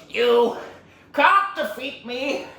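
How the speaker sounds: slow, high, loud villain defiance; hammed-up defeated villain shout; Tai Lung. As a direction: spit it out in big separate chunks.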